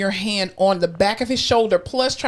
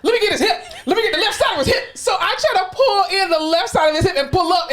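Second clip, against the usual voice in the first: high voice breaking into laughter; telling her co-host about the lift, laughing high; 9 semitones higher, faster than usual. Real, not acted. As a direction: laugh through the story. Retelling struggle while laughing.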